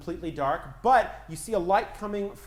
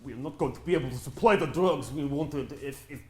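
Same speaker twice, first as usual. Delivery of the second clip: put-on Russian accent; 4 semitones lower than usual. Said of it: "Russian accents".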